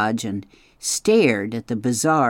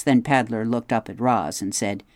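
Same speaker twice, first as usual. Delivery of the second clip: ordinary delivery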